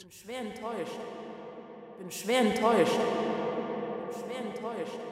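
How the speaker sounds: distant, reverberating echo fading away. Acted. Mother's words echo in the girl's head, distant and fading. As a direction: let the words echo like a dream. Echoing voice in a trance.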